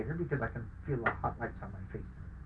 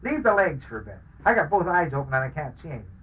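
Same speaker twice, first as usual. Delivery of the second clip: raised, clear voice, announcing it to the room; loud clear announcement; 5 semitones higher, 5 dB louder than usual. Retold or imitated. Wounded soldier tells the surgeon, loud and clear. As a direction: call it out firmly to the surgeons. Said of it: "I announce in a loud, clear voice".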